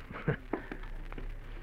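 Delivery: a soft, breathy villain's chuckle; quiet menacing chuckle. Acted. Blackmailer mocking Dave: soft sinister chuckle. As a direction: laugh low under the threat, barely voiced.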